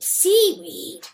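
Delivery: high, loud, strange seaweed; sudden outburst. A narrator's reading. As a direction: blurt the last seaweed out loud. Repeated word, loud take.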